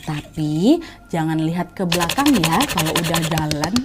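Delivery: low, slow cautionary words; slow right down and drop the pitch to warn; ominous warning. Acted. Stall keeper warning lost travellers, ominous and slow.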